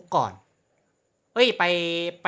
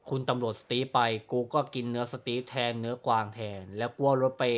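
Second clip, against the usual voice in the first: recap narration; 6 semitones lower, 4 dB quieter than usual. Acted. Gamer recaps his crimes to viewers, low deadpan recap. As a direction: lower and softer, deadpan summary.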